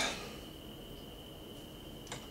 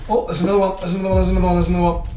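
higher, rapid-fire repetition; urgent exclamation; 5 semitones higher, 4 dB louder, much faster than usual. Real, not acted. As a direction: blurt it over and over. Spots another one, repeats it urgently.

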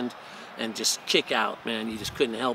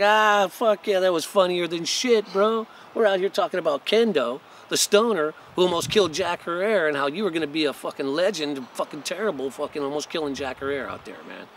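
louder, higher teasing razz; mocking buddy; 6 semitones higher, 5 dB louder than usual. His buddy mocking him for almost killing Jack Herer, teasing. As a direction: rib him like an amused friend. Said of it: "He's like".

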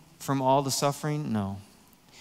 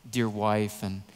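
usual speaking voice

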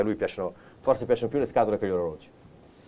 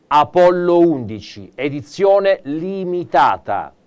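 grand announcement; 5 semitones higher, 6 dB louder, slower than usual. Acted. Grand unveiling of the watch.

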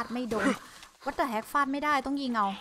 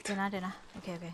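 normal talking voice

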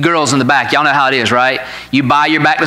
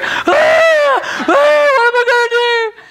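much higher wailing imitation of a sobbing girl; imitating crying; slower than usual. Father imitating his daughter crying over ripped pants, high wail. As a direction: wail it like a panicked teen in tears. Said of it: "She immediately starts crying".

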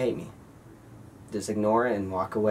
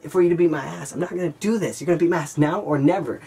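high, heated, daring him to fight; fed-up challenge pitched way up; calling the bully's bluff, heated and high; heated comeback; 8 semitones higher than usual. Retold or imitated. Teen squaring off with a bully.

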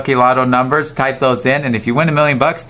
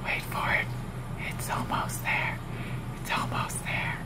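hushed whisper between gasps; tense whisper; 16 dB quieter than usual. Real, not acted. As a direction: whisper in suspense. Waiting for dice to stop.

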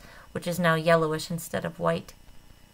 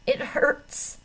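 moaning; 8 semitones higher than usual. A narrator's reading. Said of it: "moans Lynn".

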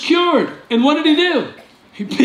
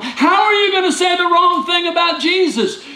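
preaching shout; 9 semitones higher than usual. Real, not acted. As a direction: throw the question at them. Preacher challenging the congregation.

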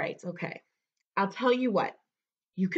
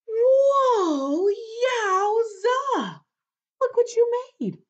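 high, loud wowed cry; amazed; 12 semitones higher, 5 dB louder, much slower than usual. Acted. Storyteller to kids who wiggled their fingers, high amazement. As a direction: draw out the wonder, pitched way up.

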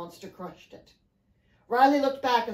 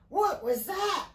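alarmed cry; 9 semitones higher, slower than usual. A narrator's reading. Riley reacts as something hits the boat, alarmed cry. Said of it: "Riley cried".

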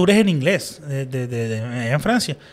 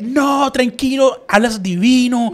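high, drawn-out gushing praise; gushing encouragement; 7 semitones higher, 4 dB louder, much slower than usual. Retold or imitated. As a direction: reassure a nervous learner with stretched, sing-song praise. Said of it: "es como que te motivan".

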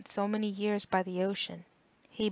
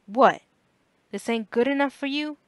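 loud, higher indignant retort; the same rough father, louder on What; father snapping again; 5 semitones higher, 9 dB louder than usual. A narrator's reading. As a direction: throw it back in his face. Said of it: "his father said again".

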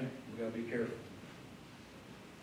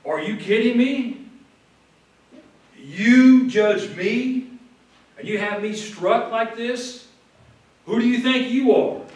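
indignant disbelief, higher and louder; taken aback; 7 semitones higher, 6 dB louder, slower than usual. Retold or imitated. Paul confronts the high priest after being struck, affronted and incredulous. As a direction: challenge them in affronted disbelief, drawing out key words. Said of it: "he was kind of taken aback for a moment".

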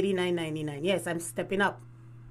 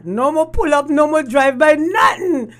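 loud exclaim; 5 semitones higher, 8 dB louder than usual. Real, not acted. Exclaiming the breakup's finality.

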